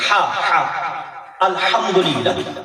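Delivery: الحمدللہ slowly, the ح from mid-throat; throat h in alhamdulillah. Shown as a demonstration. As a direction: stretch الحمدللہ and press the ح in the throat. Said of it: "حلق کے درمیانی حصے سے اس کی ادائیگی ہے". (الحمدللہ میں ح حلق کے درمیان سے)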